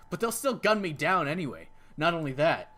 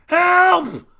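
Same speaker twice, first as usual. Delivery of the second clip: loud, high desperate yells; screaming for help; 10 semitones higher, 11 dB louder, much slower than usual. Acted. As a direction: scream for rescue with all you have. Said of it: "I scream at the top of my lungs".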